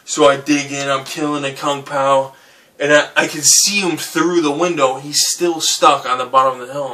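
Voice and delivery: usual speaking voice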